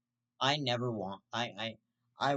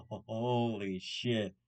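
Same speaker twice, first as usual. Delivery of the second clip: slow stoned awe; 2 semitones lower, much slower than usual. Retold or imitated. Imitating stoned epiphany.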